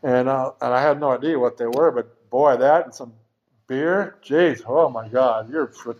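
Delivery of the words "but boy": ordinary talk, nothing marked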